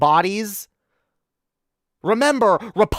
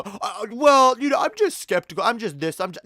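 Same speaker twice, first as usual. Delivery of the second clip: hesitant, whiny mock skeptic voice, a bit higher; mocking a vaccine skeptic; 3 semitones higher than usual. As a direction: lightly mimic a dithering skeptic making excuses. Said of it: "I've heard so many people argue".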